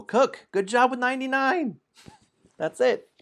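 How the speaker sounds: high excited cheer; giddy high-pitched congratulations; squealing praise. Real, not acted. Playful high praise to player.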